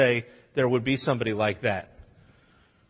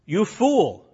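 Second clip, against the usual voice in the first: mock rebuke; 7 semitones higher, 5 dB louder than usual. Shown as a demonstration. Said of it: "You could translate this".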